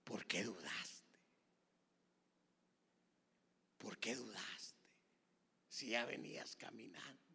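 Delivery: quiet, breath-heavy rebuke to a doubter; hushed reproach. Acted. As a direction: drop almost to a whisper, letting each question sink in. Jesus gently reproaches sinking Peter.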